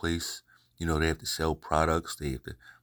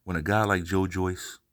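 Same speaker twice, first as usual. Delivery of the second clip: ordinary talk, nothing marked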